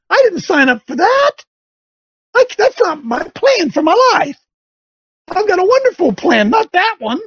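high, indignant protest; whiny convert. Retold or imitated. Convert refusing the pastor's call, high whiny protest. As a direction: whine like a spoiled believer. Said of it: "And they say".

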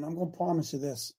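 ordinary delivery

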